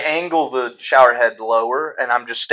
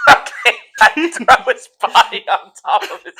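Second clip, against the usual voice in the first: laughing; 8 semitones higher, 5 dB louder than usual. Real, not acted. Piling goblin bodies, laughing.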